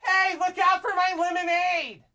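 put-on high, shouted little girl voice; little girl yelling. Girl yelling at a dad in her play area, high and shouty. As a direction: squeak it out like a bossy kid.

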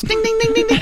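very high tinkling dings; bell imitation. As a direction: chime it like tiny bells. Wife Tinkerbelling around house.

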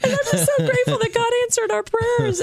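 high, laughing through tears; retelling her happy crying on the phone. Retold or imitated. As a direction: choke out gratitude while sobbing. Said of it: "And I started bawling".

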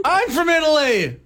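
high, indignant cartoon plumber; comic high-voiced protest in character; Mario impersonation. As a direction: pitch it way up and proclaim it. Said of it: "Let's practice your Mario impersonations".

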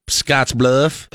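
lightly imitated Southern-style accent; retold small-town reply in a slight Southern accent; Southern-sounding accent. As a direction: answer with a hint of a Southern accent. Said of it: "you kind of have an accent".

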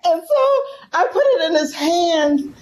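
real tears, voice breaking high; fighting back tears as the memory hits; choked-up crying. Real, not acted. Guest telling the host about her blind father, crying.

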